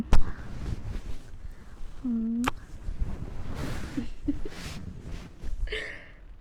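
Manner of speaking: real wheezing laugh fit; loud breathless laugh. Real, not acted.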